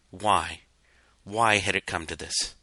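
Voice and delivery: usual speaking voice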